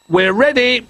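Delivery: high, excited call-out; shouted. A narrator's reading. Little Bear signals the crane crew, shouting. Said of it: "he shouted".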